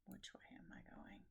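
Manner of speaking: barely audible unvoiced whisper. Real, not acted. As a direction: murmur it to yourself under breath. Whispered to self while knitting.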